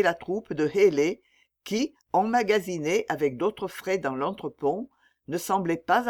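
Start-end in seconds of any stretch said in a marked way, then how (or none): none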